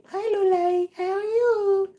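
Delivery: very high, squealy cooing greeting. Real, not acted. Greeting the pet Lolai in a squeaky baby voice.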